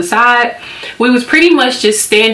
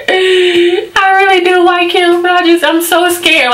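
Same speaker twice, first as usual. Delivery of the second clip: high, drawn-out mock whine; acted-out inner panic, pitched way up; hammed-up nervous fretting; 9 semitones higher than usual. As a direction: squeal it like a torn, scared crush. Voicing her own scared inner thoughts.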